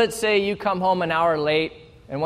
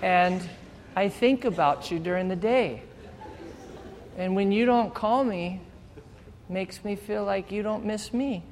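hurt, vulnerable partner; 2 semitones higher, 7 dB quieter than usual. Pretend. Partner admits missing them.

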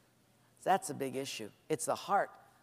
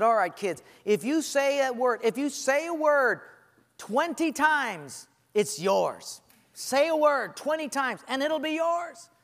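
lightly voiced eager teacher, higher; 6 semitones higher, 3 dB louder than usual. Teacher urging her class to repeat words, bright and higher. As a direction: coax the kids like a cheerful teacher.

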